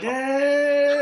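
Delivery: high, squealing yay; a codeine-happy cheer; giddy cheer. Retold or imitated. Soldier recalling codeine high, giddy high yay. Drugged-up cheer mid-march.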